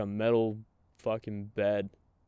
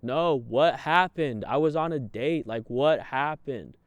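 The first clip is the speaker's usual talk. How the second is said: exclaiming; 5 semitones higher, 8 dB louder than usual. Retold or imitated. Telling a friend about being arrested, loud disbelief. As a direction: demand to know what happened. Retelling frantic protest to friend.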